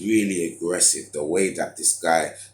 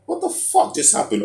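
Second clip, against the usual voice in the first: shocked disbelief; 4 dB louder than usual. Retold or imitated. Shocked outburst retelling the cement.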